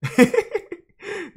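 shrieking burst of laughter; high laugh. Real, not acted. Laughing after fight.

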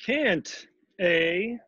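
high, affronted comeback; royal rage. A narrator's reading. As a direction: throw it back as an offended king. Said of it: "His Majesty flew into a terrible rage".

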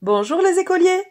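very high, loud, piping call; teasing high-pitched holler across the street; funny little shout. A narrator's reading. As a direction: cry out in a funny little girlish voice. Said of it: "d'une petite voix drôle".

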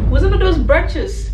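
hammed-up slow interrogation; mock accusing. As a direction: drag each word out accusingly.

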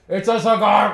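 high, loud shout to be heard; screamed over the top. Retold or imitated. Player to dealer through plexiglass and mask, screaming it. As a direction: yell it over a barrier. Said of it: "I gotta scream it over the top".

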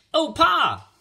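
put-on very high, loud boyish yell; high-pitched excited shout; boys shouting. A narrator's reading. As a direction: holler to Pa like an excited kid. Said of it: "the boys shouted".

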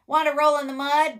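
high, loud, fast invitation; storybook pig. A narrator's reading. Pig asking spider to roll in mud, high and fast. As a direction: shoot the question out high and quick. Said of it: "grunted the pig".

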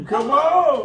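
coaxing come on with a laugh. Real, not acted. Calling the baby, laughing.

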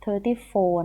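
"thirty-four" slow and emphasised as a correction; corrected number. Real, not acted. Teacher correcting the page number, slow stressed "thirty-four". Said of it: "page thirty three อ๋อ".

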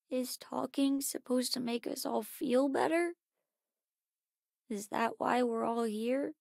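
soft, subdued questioning; hushed, withdrawn doubt; downcast. Acted. Doubtful talk after a loss.

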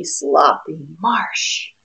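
ordinary delivery

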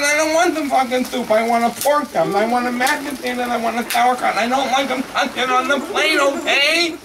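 high-pitched, loud tirade; furious rant. Acted. Guest yelling his order at the waiter, furious. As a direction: bellow the order dish by dish.

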